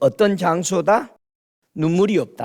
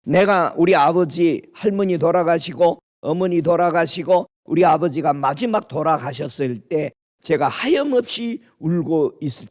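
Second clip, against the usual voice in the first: ordinary delivery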